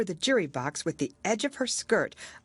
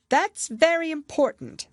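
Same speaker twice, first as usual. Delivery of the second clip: pompous king; 12 semitones higher, 6 dB louder than usual. A narrator's reading. King pronounces to jury.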